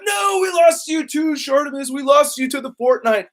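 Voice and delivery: high, hammed-up wail of protest; mock lament. Mimicking fans who lost him to Fortnite, mock wail.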